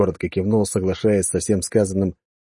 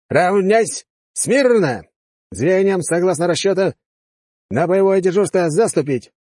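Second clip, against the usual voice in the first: high barked parade commands; shouting drill sergeant; 10 semitones higher, 4 dB louder, slower than usual. Acted. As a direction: bark the drill orders.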